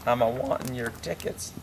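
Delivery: usual speaking voice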